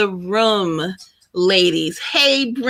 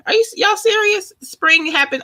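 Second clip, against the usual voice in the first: much higher, incredulous question; surprised disbelief; 12 semitones higher than usual. Real, not acted. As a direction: ask the chat if they're kidding. Spring started March twentieth.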